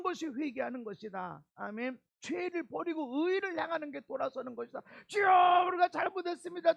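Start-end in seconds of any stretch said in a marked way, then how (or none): 5.1-6.7 loud, fast cry of repentance; crying out to God. Acted. Preacher leading the congregation in a loud cry to God. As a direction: call out to the Lord with all your voice.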